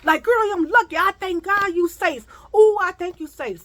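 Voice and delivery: high pitched worried warning; warning passengers. Retold or imitated. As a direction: plead with her to stay away. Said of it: "They like".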